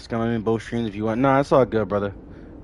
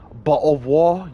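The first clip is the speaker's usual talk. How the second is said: imitating a British accent, bottle of water with the t's swallowed; mock British, t's dropped in water; a put-on British accent; 5 semitones higher, 6 dB louder than usual. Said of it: "when they can't really say bottle of water".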